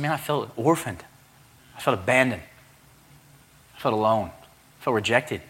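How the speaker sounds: slowing, sinking admission of hurt; mild hurt confession. Retold or imitated.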